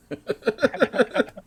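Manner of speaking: very high burst of laughter. Real, not acted. Game master laughing at the frog's tongue trick. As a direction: squeal with laughter.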